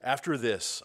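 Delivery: ordinary talk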